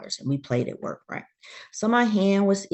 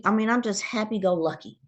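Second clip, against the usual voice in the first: normal talking voice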